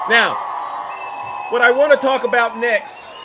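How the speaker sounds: big show announcer voice; announcer shout. Real, not acted. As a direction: hype the next topic. Announcing next topic.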